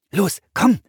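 loud, high hurry-up; urging. A narrator's reading. Brother hurries his sister out the door, urging loudly. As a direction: push the listener to get moving. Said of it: "drängte Philipp".

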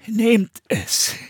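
high, trembling voice; shaky old monk; Bruder Michael. A narrator's reading. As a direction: offer it in a quavering voice. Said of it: "rief eine zittrige Stimme".

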